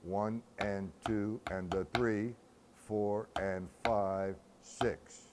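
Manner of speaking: slow, steady count in time; measured rhythmic counting; complete measure count. Shown as a demonstration. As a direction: count the whole measure out evenly.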